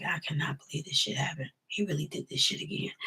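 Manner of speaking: soft, breathy whisper of hurt; whispered disbelief. Retold or imitated. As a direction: whisper it to yourself, crushed. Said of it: "I'm just like".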